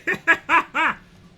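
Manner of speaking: loud high shocked cackle; burst of laughter. Real, not acted. Horrified by the game, loud high guilty laugh. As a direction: laugh despite yourself. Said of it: "I shouldn't laugh".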